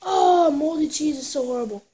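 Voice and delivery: real disgust at a foul taste; disgusted outcry. Real, not acted. Tastes moldy cheese bean.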